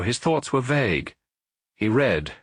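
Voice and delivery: usual speaking voice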